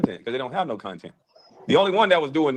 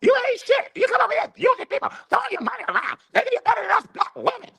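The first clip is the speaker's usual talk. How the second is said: falsetto mimicry of a woman, much higher; hammed-up high falsetto woman; mock woman's voice. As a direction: squeak it up like a mocking woman.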